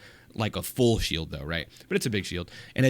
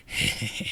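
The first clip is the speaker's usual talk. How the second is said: creepy laugh; 4 dB quieter than usual. Acted. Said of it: "just laughing about it later on".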